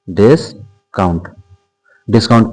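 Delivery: "Discount" slowly split into "डिस" and "count"; slow, two-part "discount"; drawn-out dictation. Shown as a demonstration. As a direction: pause inside "discount", as if writing it. Teacher spells out topic slowly.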